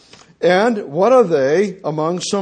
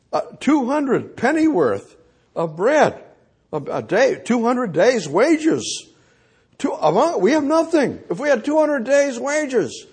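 voicing worried Philip; 7 semitones higher than usual. Philip voiced worrying aloud.

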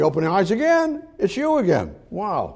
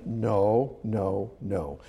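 low, soft, muttered string of noes; dismissive refusal; 10 semitones lower, 10 dB quieter, much slower than usual. Acted. Asked if we will pay attention, dismissive. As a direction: drop right down, resigned and dismissive. Answers for those not paying attention.

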